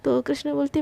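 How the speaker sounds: ordinary delivery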